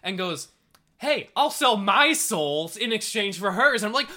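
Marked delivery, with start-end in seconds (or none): 1.0-3.8 slow, louder, higher mock noble offer; mock heroic Rico. Rico offering his soul in exchange, slow and loud. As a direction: ham up a selfless vow. Retelling Rico's sacrifice line.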